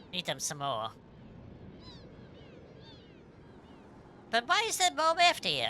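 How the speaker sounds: attempted Australian accent, lightly put on; chatty, swooping go at an Australian accent; Kid. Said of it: "if I could do an Elstarian accent".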